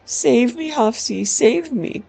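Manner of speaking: high, pleading bleat. A narrator's reading. Prince begging Hafsi to save him, bleating. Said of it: "pawing at me with his pudgy hands and bleating".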